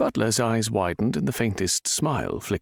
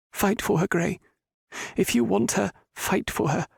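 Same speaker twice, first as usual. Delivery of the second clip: put-on higher, earnest older lady; urging older woman Roberta; 13 semitones higher than usual. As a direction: push him to fight for love, earnestly. Roberta urges Gray to fight.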